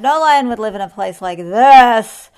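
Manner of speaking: very loud, indignant verdict; roared. A narrator's reading. Lion abandons the bed, roared. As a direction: boom it out in outrage. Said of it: "he roared".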